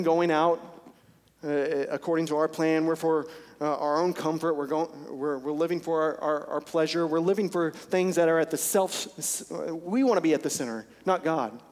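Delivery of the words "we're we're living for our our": ordinary delivery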